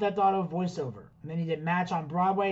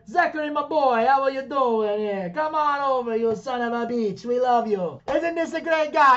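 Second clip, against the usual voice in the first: loud, higher, hearty Italian family welcome; doting Italian relative; 5 semitones higher, 4 dB louder than usual. Retold or imitated. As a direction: play the beaming host pushing food on a guest. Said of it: "they'll be like".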